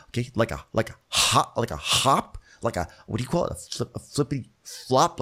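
sputtering, halting word search climbing in pitch; hammed-up flustered impersonation; Ramona. Acting Ramona fumbling for a word.

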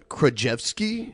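"Krajewski" said kra-JEF-skee; slow, questioning "Krajewski" with an F for the W; Polish-style W. Shown as a demonstration. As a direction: tentatively sound the W in "Krajewski" as F. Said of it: "How would they pronounce a, a W".

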